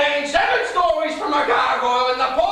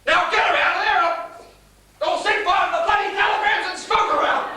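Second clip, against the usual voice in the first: angry shouting; 7 semitones higher, faster than usual. Acted. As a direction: bellow the command at her. Father ordering daughter out.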